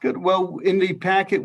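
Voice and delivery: ordinary delivery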